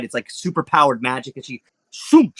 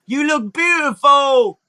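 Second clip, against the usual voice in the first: high, slow, loud love-struck call; swooning Hermes; 10 semitones higher, 4 dB louder, slower than usual. Acted. Hermes mid-air calling to Sakura, slow loud swoon. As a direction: proclaim it dreamily and loud. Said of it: "And he goes like".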